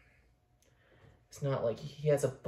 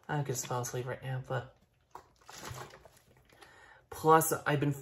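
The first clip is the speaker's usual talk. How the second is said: normal talking voice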